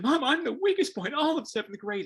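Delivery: put-on high, loud distraught kid voice; upset kid. Seventh grader telling mom he is the weakest, distraught. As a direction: wail it like a crushed twelve-year-old.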